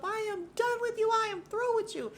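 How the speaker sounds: high-pitched imitated tirade; mock outburst. Jacqueline jumping up to cut Mia off, mock outburst. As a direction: shriek it like a dramatic breakup. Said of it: "And she jumps up".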